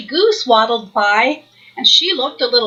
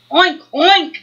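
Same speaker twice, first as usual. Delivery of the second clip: oinking; 4 semitones higher than usual. Acted. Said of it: "said Duddle".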